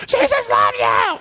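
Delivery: mock street yell. Christians yelling at passersby, imitated as a loud drawn-out shout. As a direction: holler it like a street preacher at strangers. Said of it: "heard Christians yell that at other people".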